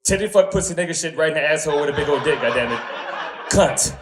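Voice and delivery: nervous guest blurting every banned word on air. Retold or imitated. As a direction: let the banned words spill out at once. Blurts all banned words.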